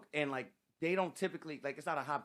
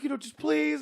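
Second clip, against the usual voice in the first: mock pleading; 14 semitones higher, 6 dB louder than usual. Designer pleading, imitated.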